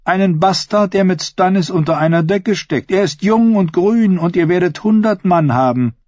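high-pitched queen coaxing a man; persuasive scheming queen. A narrator's reading. Queen to her would-be killer, persuasive. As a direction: coax him in a lifted, lively voice.

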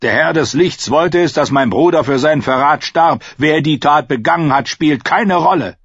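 the king declares the matter closed; stern king. A narrator's reading. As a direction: dismiss the question coldly. Narrator voices King Stannis.

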